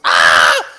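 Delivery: sudden loud yelp of a startled goblin; one sharp loud goblin cry; goblin shriek. Acted. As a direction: shriek it out, caught off guard. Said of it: "this goblin goes".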